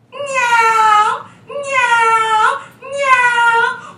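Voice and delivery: high, loud yowling meows; high drawn-out cat cry. Acted. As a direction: yowl like a menacing cat. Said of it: "sounded exactly like this".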